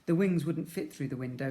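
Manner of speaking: ordinary delivery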